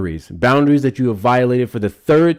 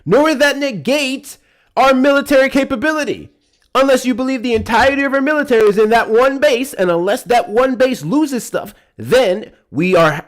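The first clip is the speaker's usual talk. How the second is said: much higher, louder, slowing down; heated rant; 10 semitones higher, 5 dB louder than usual. Real, not acted. Host ranting at the caller's argument, heated. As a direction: build the argument up indignantly.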